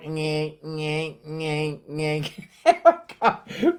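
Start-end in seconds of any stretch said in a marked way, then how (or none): 0.1-2.5 soft buzzing dot-matrix printer imitation; imitated printer noise. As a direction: mimic a printer head zipping back and forth. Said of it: "and it would go".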